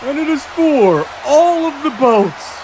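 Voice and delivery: drawn-out, very slow ring introduction; ring announcer. Acted. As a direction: announce the champion, stretching out the name.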